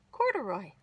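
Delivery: ordinary delivery, nothing marked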